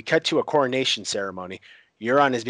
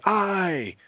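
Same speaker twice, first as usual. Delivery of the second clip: high cheering shout of the crowd; 10 semitones higher than usual. Retold or imitated. Crowd roars agreement to Yara, high shout.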